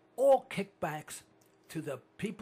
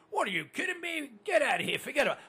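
higher New York brush-off; New York accent; 8 semitones higher, faster than usual. Retold or imitated. Host answering a guy about the war, New York accent. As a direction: wave off the news like a New Yorker. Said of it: "Another guy comes up to me".

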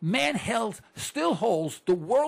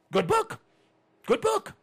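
mock approval; 12 semitones higher, slower than usual. Mocking public praise.

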